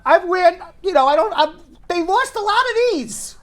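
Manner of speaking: high-pitched comic exasperation; exasperated rant. Acted. Customer showing what the cleaners lost, exasperated rant. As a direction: sputter it out, high and worked up.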